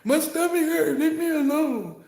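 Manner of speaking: high, loud mock plea, slowing at the end; pleading friend. Friend begs the joker to leave them alone, high. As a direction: whine high that it hurts from laughing. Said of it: "I done had people tell me".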